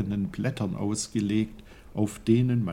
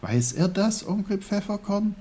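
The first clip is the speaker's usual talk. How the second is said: put-on high-pitched little-boy voice; pondering boy Peter; 6 semitones higher than usual. A narrator's reading. Peter works it out and asks his uncle, high. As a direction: think it out in a high child's voice.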